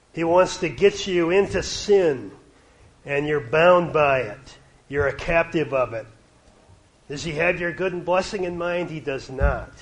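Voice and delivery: loud, pressing sermon delivery; preaching. Acted. Preacher warning young people about sin, loud and urgent. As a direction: drive it home to the young people.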